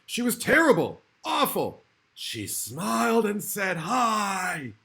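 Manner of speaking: a shrieking storyteller building to her "Hi."; mock horror. A narrator's reading. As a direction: ham up the terrible dentist's reveal. Dentist revealed, mock horror.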